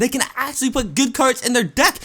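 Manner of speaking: high-pitched frustrated rant; vent, fed up and whiny; exasperated rant. Real, not acted. Ranting about the challenge.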